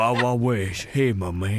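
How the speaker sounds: mock Texas drawl; playful Texan accent. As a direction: put on a cowboy-style Texan greeting. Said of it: "and then Texas".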